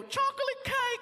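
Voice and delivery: very high, drawn-out squeal; sing-song falsetto, slow; quoted catchphrase. Retold or imitated. Cop quoting comedian's famous joke, high squeal. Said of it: "when you go".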